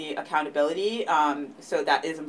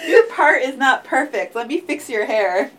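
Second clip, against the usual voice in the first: higher, louder mock fussing through a laugh; fussy nitpicking partner; 8 semitones higher, 7 dB louder than usual. Virgo partner fussing over the other's hair, mock nitpicking while laughing. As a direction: nitpick like a doting perfectionist, laughing. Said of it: "acting fussy Virgo partner".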